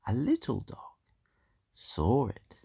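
hushed storyteller whisper. A narrator's reading. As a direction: breathe it, building suspense. Storyteller whispers the dog's arrival.

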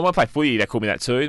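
ordinary talk, nothing marked

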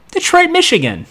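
very high ironic "Detroit, Michigan"; sarcastic punchline. Retold or imitated. Mocking Detroit among famous DC cities, sarcastic. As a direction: land it as a joke.

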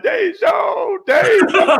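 high, wailing fake sob of a name; mock crying. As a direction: cry the name out, hammed-up and high. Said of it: "Did you cry and be like".

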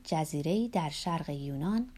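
ordinary delivery

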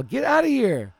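very high, loud get out; angry yell. Retold or imitated. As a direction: chase her off. Ordering woman away.

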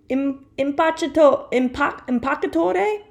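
unsure Italian accent, rising to a question; hesitant, high Italian accent; Italian accent attempt. Shown as a demonstration. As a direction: fumble through "impacciatore" in an Italian accent. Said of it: "I'm gonna say this in an Italian accent".

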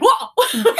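high, loud startled gasp-word; startled whoa. Retold or imitated. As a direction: jump back shocked, voice shooting up. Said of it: "Jesse comes and he's like".

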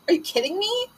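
incredulous. Retold or imitated. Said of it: "had this look on my face like".

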